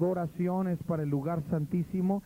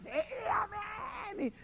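mock amen cry; 7 dB quieter, much slower than usual. Imitating congregation response.

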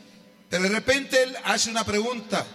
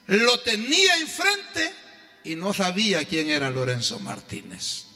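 preaching; 4 semitones higher than usual. Acted. Preaching at full force.

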